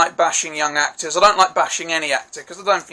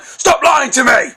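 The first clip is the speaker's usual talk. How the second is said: high, loud angry shout; sudden angry outburst; 8 semitones higher, 6 dB louder than usual. Acted. Reviewer demonstrates the scene's abrupt shift from normal chat, angry shout. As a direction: burst out suddenly, forced and furious. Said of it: "then I just randomly went".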